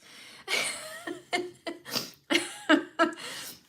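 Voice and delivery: long high laugh; high tearful laughter. Real, not acted. Vlogger laughing at viewers for making her cry. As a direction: laugh it off through tears.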